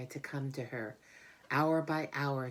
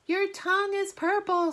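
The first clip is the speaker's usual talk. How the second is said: very high, loud scream; 8 dB louder than usual. Acted. Fern screaming at Avery as he swings, very high and loud. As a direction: shriek it at him. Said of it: "screamed Fern".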